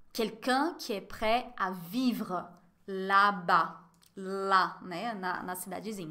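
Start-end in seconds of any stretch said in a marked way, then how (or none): 0.1-3.8 slow model reading. Shown as a demonstration. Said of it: "Olha a pronúncia".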